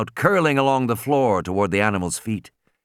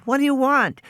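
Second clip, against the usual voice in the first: put-on high girl's voice; frightened child asking; young girl. A narrator's reading. Girl answering scared.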